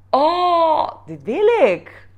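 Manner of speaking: high, loud burst of wanting; thrilled. Retold or imitated. Looking at her vision board, thrilled and loud. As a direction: gasp it out like you finally see it.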